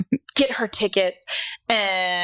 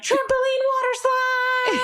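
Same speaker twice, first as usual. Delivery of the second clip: put-on much higher, slow gleeful kid voice; drawn-out squeaky kid announcement; excited little boy. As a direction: announce it like a thrilled small boy. Excited boy announcing his idea.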